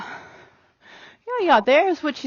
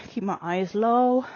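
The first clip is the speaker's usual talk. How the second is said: normal talking voice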